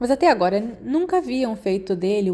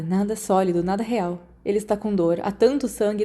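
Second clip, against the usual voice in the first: usual speaking voice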